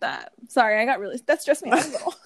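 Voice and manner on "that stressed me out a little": laughs while admitting stress; sheepish laughing admission; real laugh through the words. Real, not acted.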